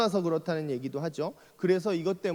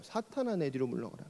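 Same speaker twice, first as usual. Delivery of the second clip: softer, severe rebuke falling low; stern rebuke; 5 semitones lower, 7 dB quieter than usual. Retold or imitated. Jesus rebuking Peter, severe and quiet. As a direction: hush it with severity. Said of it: "되게 혹독하게 혼나는".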